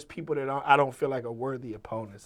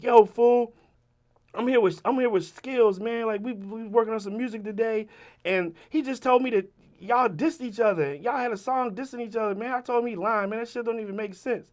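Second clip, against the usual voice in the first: lightly raised, higher friend's voice; retold video shout-out, pitched up; imitated video message; 8 semitones higher, 3 dB louder than usual. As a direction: talk up to the camera for a buddy.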